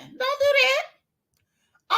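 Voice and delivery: drawn-out, very high squeal; mock warning. YouTuber telling Necrosis to stop copying Robert, high mock warning. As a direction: stretch it out high in mock alarm.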